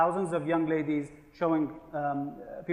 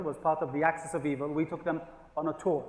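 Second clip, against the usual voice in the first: ordinary delivery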